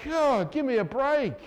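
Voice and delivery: loud, high groan of exasperation; exasperated parent. Acted. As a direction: burst out, utterly fed up with the apologies. Acts the fed-up parent's reply.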